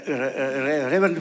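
halting stammer on Reverend, a nervous half-laugh; stuttering. Retold or imitated. Asking the Reverend for permission to wash his feet, nervously stuttering. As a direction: trip over "Re-, Re-, Re-, Reverend" before getting it out. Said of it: "And stutteringly".

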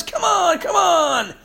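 high pleading shout of come on; urging a fish. Retold or imitated. Preacher reliving waiting at the lure, urgent high shout. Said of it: "I was".

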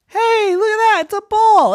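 high, loud thrilled cry; excited. Acted. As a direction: squeal with delight at the find. Deer finds ball.

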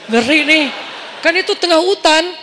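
loud, high retold panic; mock-frightened inner panic; comically spooked. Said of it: "Aduh ngeri banget gini".

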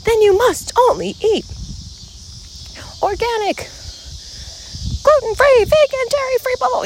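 high, preachy purist voice; mocking health purist. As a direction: parody a diet zealot, high-pitched. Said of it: "people being like".

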